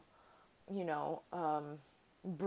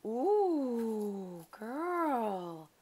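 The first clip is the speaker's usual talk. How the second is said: long, swooping ooh, louder; excited admiration; 4 semitones higher, 5 dB louder than usual. Real, not acted. Crafter reacting to a friend's handmade gift, excited admiration. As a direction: draw it out, rising high then falling.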